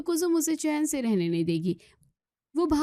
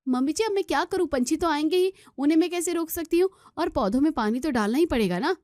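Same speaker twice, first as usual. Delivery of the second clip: put-on much higher, quick protesting young wife; exasperated daughter-in-law; 8 semitones higher, faster than usual. Daughter-in-law protests to mother-in-law, high and exasperated. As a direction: throw up her hands in protest.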